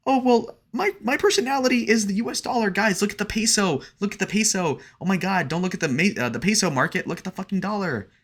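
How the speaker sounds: high, airy mock valley-girl whine; mocking high girly voice. Mocking a crypto influencer, high mock valley-girl voice. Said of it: "straight up saying".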